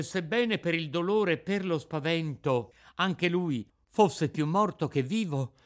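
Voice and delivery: ordinary delivery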